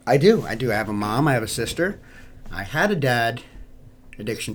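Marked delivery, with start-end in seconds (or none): none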